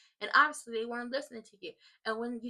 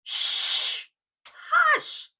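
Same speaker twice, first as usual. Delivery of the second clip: loud shush then high hush; shushing herself; 11 dB louder than usual. Pretend. Vlogger shushing her own chatter, loud high hush. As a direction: hush yourself loudly. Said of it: "'cause y'all know I could talk".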